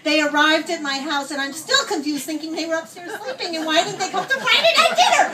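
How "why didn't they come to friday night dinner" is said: high, rushed, laughing bewilderment; mock indignant. Host puzzled by the missed family dinner, mock indignant. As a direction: blurt it out fast and high, comically put out.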